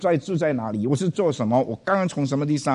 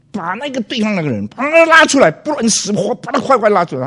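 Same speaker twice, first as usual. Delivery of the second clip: high, loud furious bellow at the other officer; retold angry yelling; 9 semitones higher, 9 dB louder than usual. Police officer yelling at colleague to pull the driver out, high and loud. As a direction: roar the order to drag him out. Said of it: "他大喊大叫骂".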